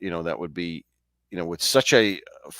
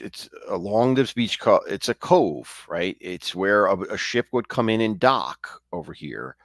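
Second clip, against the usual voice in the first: usual speaking voice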